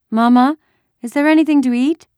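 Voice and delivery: put-on high, loud child's question; young girl. A narrator's reading. Daughter asks mother for food, eagerly.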